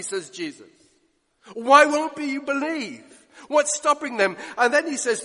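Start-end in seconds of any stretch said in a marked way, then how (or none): 1.5-3.1 higher, louder, slow anguished plea; pleading cry. Acted. Jesus pleading with the Pharisees, loud slow appeal. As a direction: beg them to believe, slowly.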